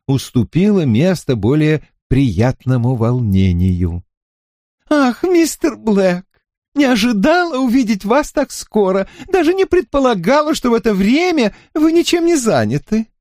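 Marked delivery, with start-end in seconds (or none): 4.9-13.1 high, quick, fluttery landlady; fussy shopkeeper voice. Acted. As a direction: chatter eagerly, pitched up high.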